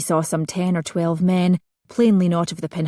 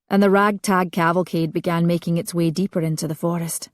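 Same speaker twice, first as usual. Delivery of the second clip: plain reading voice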